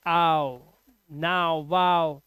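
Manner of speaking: low आओ, नाउ, वाओ with open glides; 'ow' sound. Shown as a demonstration. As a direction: rhyme आओ, नाउ, वाओ with cow. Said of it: "और आओ भी आता है".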